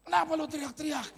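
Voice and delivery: loud, high shouted retort; teriak balik. Retold or imitated. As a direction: yell it back at a screaming driver. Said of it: "Teriak juga saya". (teriakan marah dengan nada tinggi)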